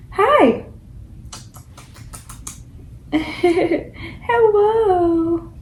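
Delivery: very high giggly coo; high breathy giggling hello. Real, not acted. Mom greeting her baby, laughing.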